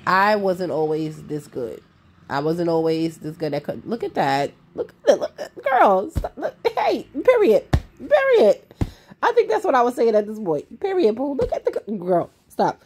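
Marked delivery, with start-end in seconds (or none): none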